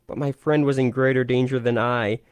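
ordinary delivery, nothing marked

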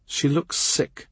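plain reading voice

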